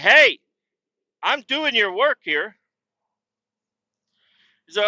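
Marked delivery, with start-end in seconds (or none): 0.0-2.6 loud high shout, then slow sarcastic jab; loud call-out. Retold or imitated. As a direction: call him out for standing around. Calling out the idle driver.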